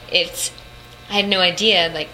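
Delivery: ordinary talk, nothing marked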